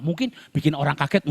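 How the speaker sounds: ordinary talk, nothing marked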